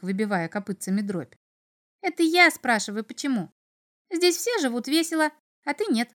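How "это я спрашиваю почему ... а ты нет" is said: high, piping imp voice; chattering little devil. A narrator's reading. Little devil insists he asks the questions, chattering. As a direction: protest in a small, high creature voice. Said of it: "затараторил чертёнок".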